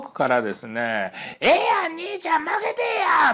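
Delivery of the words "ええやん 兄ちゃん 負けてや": much higher, lively Kansai dialect bargaining call; Kansai dialect haggling. Retold or imitated. Tourist in Venice haggling with a vendor, high Kansai dialect. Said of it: "っていう関西弁が聞こえてきました".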